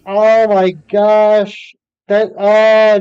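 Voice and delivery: loud, slow, drawn-out laughing gasp; finally getting the joke and cracking up; laughing realization. Real, not acted. Streamer finally gets the germs joke, loud laughing gasp.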